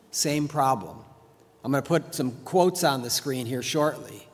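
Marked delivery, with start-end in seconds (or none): none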